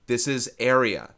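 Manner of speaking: "area" stressed loud and falling; emphatic stress. Shown as a demonstration. Teacher correcting earlier units, stressing "area". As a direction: lean hard on "area". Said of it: "I mentioned that loudly enough".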